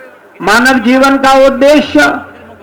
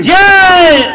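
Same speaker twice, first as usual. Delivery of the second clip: crowd roaring the reply; shouted response; 5 semitones higher than usual. Real, not acted. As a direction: shout it back as one crowd. Crowd answers rally chant. (ज़ोर से जवाबी नारा)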